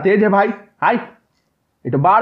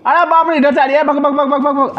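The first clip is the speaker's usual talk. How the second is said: high-pitched rapid alarm; panicked urging to run, words tumbling out; startled panic; 7 semitones higher than usual. Real, not acted. Granny suddenly comes, he flees. (घबराहट में ऊँची आवाज़)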